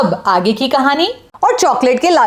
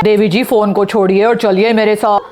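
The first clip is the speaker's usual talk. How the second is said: lower, urgent man's voice; urgent father; 6 semitones lower than usual. Acted. Yana's father urges a mother off her phone, low and urgent. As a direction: hurry the distracted mother along.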